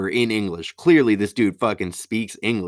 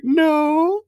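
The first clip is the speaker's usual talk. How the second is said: high, loud hammed-up wail; mock crying; 4 dB louder than usual. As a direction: wail it out like an exaggerated sobbing customer. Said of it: "hear the one start to cry".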